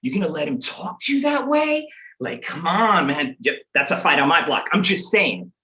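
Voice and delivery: goading friend egging someone on; mock-provoking street-corner needling; instigating. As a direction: stir up a fight, pitch jumping up. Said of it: "instigating myself".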